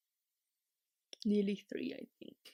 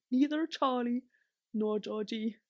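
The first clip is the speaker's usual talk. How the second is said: ordinary delivery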